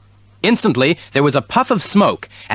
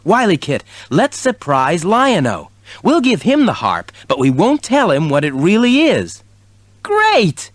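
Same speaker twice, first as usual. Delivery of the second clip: kitten voice; 7 semitones higher than usual. A narrator's reading.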